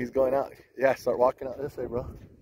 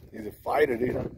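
usual speaking voice

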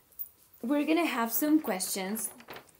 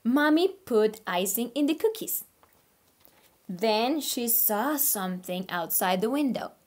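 put-on reading to children; 3 dB louder than usual. Teacher reading a story to her online class, animated. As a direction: read aloud like a picture book for little kids. Reads picture book aloud.

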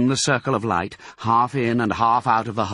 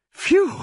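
high, louder exhaled phew; relieved phew; 5 dB louder than usual. A narrator's reading. Mister Fox after frantic digging, relieved phew. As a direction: let out a big relieved phew.

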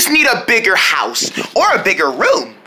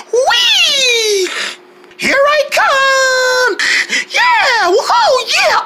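high gleeful shouts; excited cheering; much slower than usual. Pretend. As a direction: whoop it up on a ride. Pretend ride, cheering.

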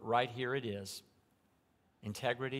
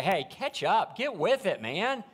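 a hint of a bossy leader calling out, higher and louder; mock pushy leader; 9 semitones higher, 8 dB louder than usual. Paul imitating a leader he wasn't, in a mock loud call. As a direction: play the leader barking at stragglers.

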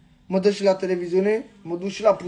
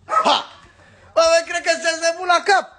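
high, fast incredulous jeer; mocking high-pitched taunt; scornful outburst; 7 semitones higher than usual. Real, not acted. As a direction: throw it at them in disbelief. Mocking outrage at CNA.

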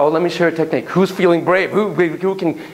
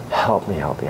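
soft whispered repeat of the plea; 10 semitones lower, 3 dB quieter than usual. Acted. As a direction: whisper the plea again, pleading.

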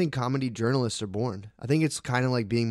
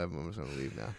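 sheepish mumble; 7 semitones lower, 12 dB quieter, faster than usual. Retold or imitated. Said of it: "And I was like".

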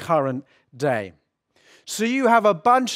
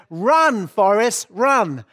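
raised voice, louder and higher, urging him on; Jenny's urgent call; 4 semitones higher, 5 dB louder, slower than usual. Retold or imitated. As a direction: call out the famous film line. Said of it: "he, she says".

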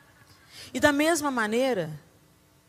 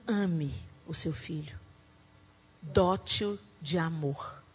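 lower, slow and soft counsel; gentle counsel; 5 semitones lower, 4 dB quieter than usual. Real, not acted. Preacher advising mothers, gentle slow counsel. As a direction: speak it tenderly, slowly.

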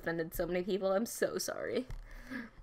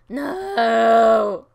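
loud drawn-out "No!"; sudden hammed-up shout; dramatic shout; 16 dB louder than usual. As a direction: yell it out big and long. Sudden mock cry during play.

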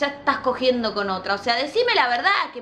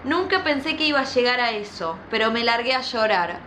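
ordinary talk, nothing marked

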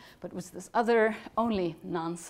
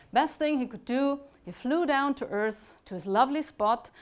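plain reading voice

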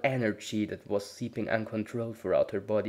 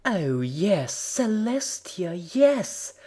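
high, loud gasping cries of delight; gasping ecstatic cry; 10 semitones higher, 7 dB louder, much slower than usual. Acted. Twilight crying out in pleasure on the massage table, high and loud. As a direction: let it burst out, rising higher each word.

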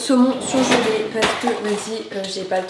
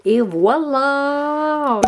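drawn-out voilà; 4 semitones higher, 5 dB louder, much slower than usual. Real, not acted.

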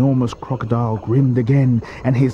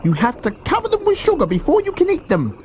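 high-pitched little-croc voice; high, insistent character voice; not-so-big crocodile, disapproving. A narrator's reading. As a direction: protest way up high, as a small croc. Smaller crocodile in story.

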